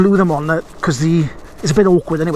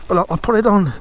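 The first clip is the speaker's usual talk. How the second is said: ordinary talk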